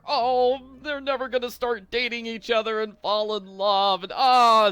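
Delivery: high, loud hammed-up wail of heartbreak; mock despair. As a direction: melodramatic fake sobbing over a crush. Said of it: "it's, it's just tragic".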